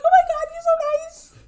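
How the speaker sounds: high, loud, teary gush; overwhelmed with thanks, voice high and tearful. Retold or imitated. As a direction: gush back at a kind stranger. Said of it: "I'm just like".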